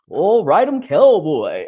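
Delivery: loud, whooping mock cowboy; cowboy mimicry. People hearing she's from Texas, mock cowboy holler. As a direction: holler like a put-on rodeo cowboy. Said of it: "first thing that people do when they hear that I'm from Texas".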